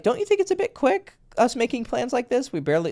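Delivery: lightly mocking girl voice; high girly voice. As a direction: play a wary girl slowing things down. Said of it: "she was like".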